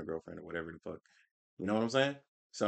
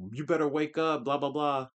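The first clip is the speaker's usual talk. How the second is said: higher mock voice of a preachy anti; mocking anti-fan; 6 semitones higher, 4 dB louder than usual. Streamer mocking anti-fans telling fans to wake up, mock voice. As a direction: sneer it like a know-it-all fan. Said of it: "if you're saying this".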